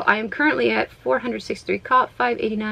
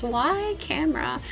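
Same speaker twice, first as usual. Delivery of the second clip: lightly put-on plaintive character voice; a hint of cartoonish pleading, pitched up; silly accented voice; 5 semitones higher, 5 dB quieter, slower than usual. Streamer pleading with her camera, mildly silly voice. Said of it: "What is this voice I'm doing?".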